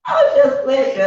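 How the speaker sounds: laughing through the words. Real, not acted. Joking after drink remark.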